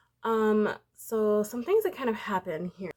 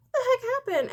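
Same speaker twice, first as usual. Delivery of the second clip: higher, louder repeat of the question; sharper, near-shouted; mock alarm, pitched way up; 6 dB louder, faster than usual. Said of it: "she's like".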